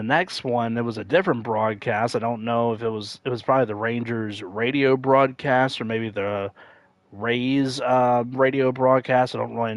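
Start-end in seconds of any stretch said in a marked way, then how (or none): none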